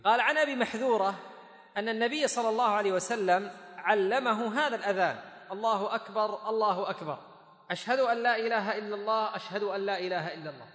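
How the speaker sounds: loud, high recitation of a narration; reading out text. A narrator's reading. Teacher reads Abu Mahdhura's hadith to students, loud formal reading.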